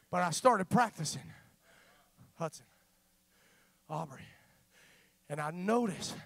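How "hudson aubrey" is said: calling softly. Shown as a demonstration. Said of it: "speaking in my normal tone of voice".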